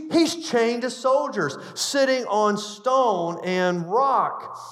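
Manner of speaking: preacher building excitement; fervent preaching. Acted. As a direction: drive the point home to the congregation. Preaching with rising force.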